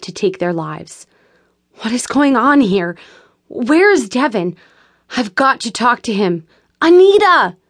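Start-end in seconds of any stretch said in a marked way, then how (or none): 1.8-7.6 rising panic ending in a loud call; frantic questions, then a high shout; alarmed. A narrator's reading. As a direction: demand answers, then call out for Anita. Rachel panics, calls Anita.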